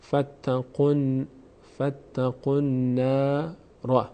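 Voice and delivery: فتقن نارا with نون held and joined; slow recitation demo. Shown as a demonstration. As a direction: slowly link فتقن into نارا. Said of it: "قاف مستقیم با حرکت ضمهٔ خودش میچسبه به نون".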